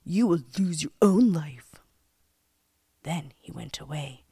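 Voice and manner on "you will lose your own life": lightly voiced menacing threat, a little louder; storybook villain laying down a threat; threatening old man. A narrator's reading.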